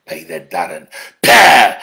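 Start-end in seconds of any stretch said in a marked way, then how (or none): 1.2-1.8 a sharp, disgusted spitting sound; spit. Real, not acted. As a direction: spit at him in contempt. Spitting at Peter Dutton.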